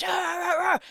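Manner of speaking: high, shouted arr barks; militant grunt. Retold or imitated. Imitating the hip hop preacher's shouts, mock militant bark. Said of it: "he has, like, this".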